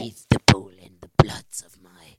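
low, slow whispered demon. Pretend. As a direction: growl it like a demon. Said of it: "This is my demon voice".

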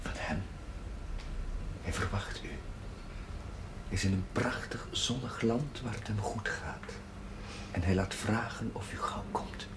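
hushed and low, a whisper; whispered news. Acted. Priest whispering good news to the grieving mother. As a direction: breathe the good news gently.

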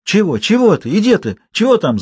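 put-on high-pitched, confused old woman; querulous high mumble; muttering old woman. A narrator's reading. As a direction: question as if roused from bed. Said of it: "забормотала старуха на своей кровати".

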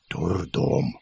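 low, soft grumble; exasperated grumble under the breath; grumbling. A narrator's reading. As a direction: mutter it to yourself. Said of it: "пробурчал я".

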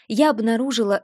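ordinary delivery

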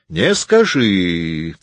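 very slow, drawn-out objection; said slowly. A narrator's reading. Martin disputing his cousin's point, said slowly. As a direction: stretch the words right out. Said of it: "проговорил Мартин медленно".

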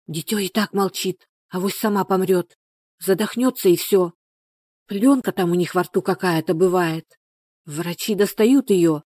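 usual speaking voice

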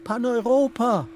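high, loud call. A narrator's reading. Dreaming Schmitz calls out to the nymph, high and loud. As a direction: call her name out across the meadow. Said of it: "ruft er".

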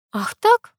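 high, indignant challenge; mildly angered. A narrator's reading. Girl reacts to her friend's insult, indignant. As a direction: bristle at the jibe, pitch jumping up. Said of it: "рассердилась я".

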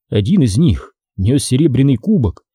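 ordinary delivery, nothing marked

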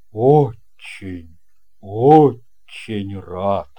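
low, loud, booming sea captain; a hearty old captain welcoming a guest; captain's voice. Acted. Captain greets boy. (басовитый голос капитана)

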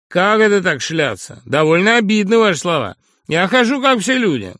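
high, loud, cocky and aggrieved; a brash lout talking back; crude upstart voice. Acted. Brash Sharikov talks back to the professor, high and loud.

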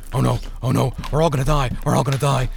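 mumbling imitation of a burdened man; mumbled mock impression. A narrator's reading. Son mimics his burdened father for the family, mock mumbling. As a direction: mumble it over and over, mocking. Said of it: "he mumbled".

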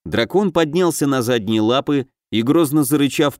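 usual speaking voice

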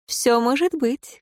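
coy and playful; coquettish. A narrator's reading. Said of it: "кокетливо стрельнула хитрым взглядом".

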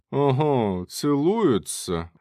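high, drawn-out jeer; mocking. A narrator's reading. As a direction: tease the lovers with mock amazement. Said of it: "насмешливый голос Штирнера".

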